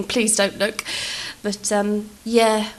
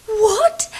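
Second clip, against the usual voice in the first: high, loud startled what; shocked what; 13 semitones higher, 6 dB louder than usual. Retold or imitated. Caught sunburnt by neighbours, a startled high what. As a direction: caught out and stunned.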